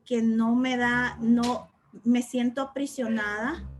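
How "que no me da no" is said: ordinary talk, nothing marked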